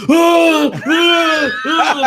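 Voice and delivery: very high, loud gasping shrieks; startled yelps. Retold or imitated. Dad acting out being woken suddenly, startled yelps. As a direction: re-enact jolting awake in alarm. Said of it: "I totally scared her".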